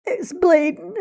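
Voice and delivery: high, loud sobbing through the words; crying. A narrator's reading. Crying to Mama over a broken heart, sobbing. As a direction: sob it out, heartbroken. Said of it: "I cried".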